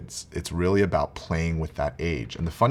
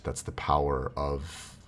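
normal talking voice

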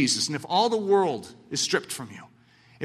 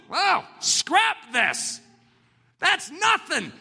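shout; 9 semitones higher, much slower than usual. Acted. Preacher dismissing worldly things.

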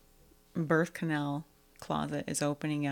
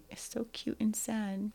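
normal talking voice

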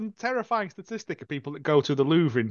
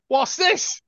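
high, loud exaggerated curiosity; hammed-up inquisitive squeal; mock nosy guest; 10 semitones higher, 5 dB louder than usual. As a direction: pipe up like a nosy visitor. Imitating nosy guest picking things up.

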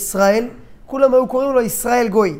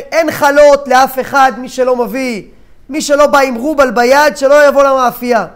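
raised, louder baker's yell; angry shouting; 3 semitones higher, 8 dB louder than usual. Acted. Baker turning away customers who didn't bring money, shouting. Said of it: "צעקות".

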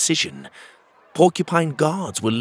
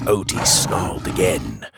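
ordinary delivery

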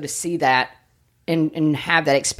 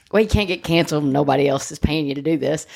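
normal talking voice

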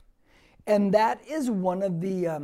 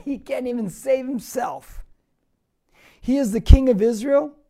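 a hint of scornful jeering, swelling louder at the end; mocking; 4 semitones higher, 10 dB louder than usual. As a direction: taunt the man on the cross. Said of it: "And it's mocking".

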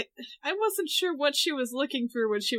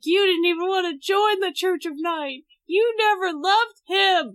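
loud, tearful outburst; crying accusation; 6 semitones higher, 5 dB louder than usual. Retold or imitated. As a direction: hurl it at her sister through tears. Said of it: "like, crying".

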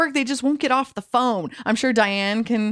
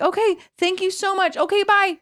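high bright rushed sign-off; cheery phone goodbye; 11 semitones higher than usual. Retold or imitated. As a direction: wrap up the call sweetly and fast. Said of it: "I'm like".

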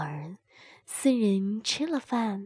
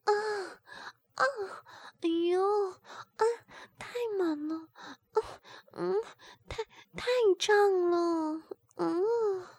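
much higher voiced moans; moaning; 10 semitones higher than usual. A narrator's reading. Sister voiced by the narrator, high strained moans. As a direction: moan high and strained between words. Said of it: "吴敏不停地哼哼着".

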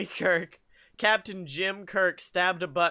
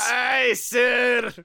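raised, emphatic Scottish engineer; louder, hammed-up Scottish reply; clipped Scottish accent; 4 semitones higher, 5 dB louder than usual. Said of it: "replied in his clipped Scottish accent".

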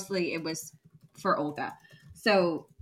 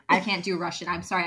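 usual speaking voice

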